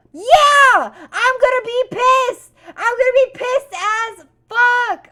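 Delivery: very high, loud angry yelling; furious shout. Acted. Ranting about taxes taking business money, furious shout. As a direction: blow up with outrage. Outraged at losing hard-earned money.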